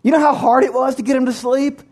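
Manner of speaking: exasperated, high-pitched scolding; acted outrage, pitch jumping up without full volume; mock indignant yell. Woken parent yelling at neighbor through intercom, mock indignant. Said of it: "yell at him through the intercom".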